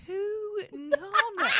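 slow, high, drawn-out question; puzzled. Retold or imitated. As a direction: stretch it out, baffled. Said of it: "she's like".

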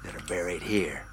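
hushed ghost-story whisper; spooky whisper. Acted. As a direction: whisper it like a campfire tale.

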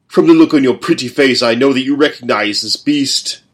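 loud, taunting brute; gloating father. A narrator's reading. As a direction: relish the boy's fear.

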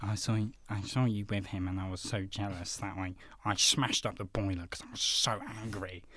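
low, soft mock confession; sheepish confession. Boyfriend confessing jealousy to his girlfriend, mock-earnest soft low voice.

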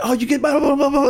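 mock hyped-up fast talker; too excited. Shown as a demonstration. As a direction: gush it out like an overeager salesman. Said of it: "could have said everything I just said really fast".

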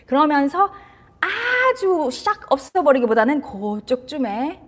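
normal talking voice

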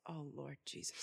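low, soft, weary groan; exasperated sigh. Acted. Saul despairing at the boy with a sling, low weary groan. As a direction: give up on him under your breath. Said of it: "Saul was like".

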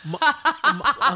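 trips on the word through laughter; laughing stumble. Real, not acted. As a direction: giggle while stumbling.

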